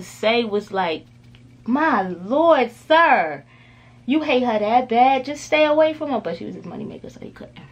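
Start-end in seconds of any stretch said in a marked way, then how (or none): 1.7-6.2 louder, higher exasperated plea. Retold or imitated. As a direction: plead with him, fed up. Exasperated reaction to Ike's lines.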